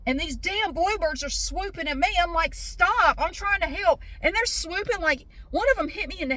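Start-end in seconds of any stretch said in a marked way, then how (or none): 2.5-4.0 raised, higher pleading yell; yelling at birds. Retold or imitated. Woman yelling at swooping bluebirds, high exasperated yell. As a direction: shoo off attacking birds while trying to help.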